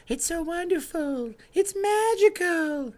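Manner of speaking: very high, slow, breathy pop-star impression. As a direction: float it up high and slow, dreamy.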